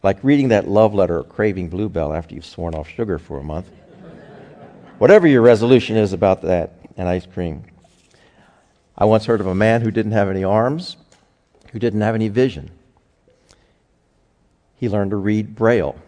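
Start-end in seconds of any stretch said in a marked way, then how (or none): none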